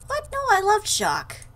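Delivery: high, loud shocked denial; indignant protest. Acted. Narrator tells John the accusation is untrue, indignant protest.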